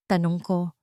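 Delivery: ordinary delivery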